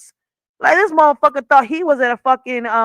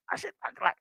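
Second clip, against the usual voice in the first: breathless whisper; 8 semitones higher, 13 dB quieter, much faster than usual. Real, not acted. Reliving the painful bite.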